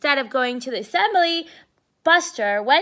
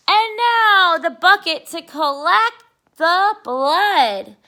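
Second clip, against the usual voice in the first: spooky showman; 5 semitones higher, 9 dB louder, slower than usual. Acted. Arthur spooks Binky on stage.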